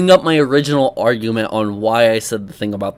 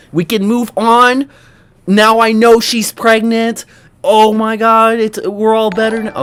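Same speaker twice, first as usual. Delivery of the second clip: sarcastic relief; 7 semitones higher, 6 dB louder than usual. Acted.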